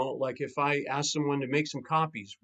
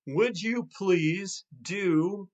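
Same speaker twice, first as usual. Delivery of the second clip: very slow, drawn-out request; slow model request; 4 semitones higher, much slower than usual. Shown as a demonstration. Coach recapping the request formula, very slow. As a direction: lay each word out slowly.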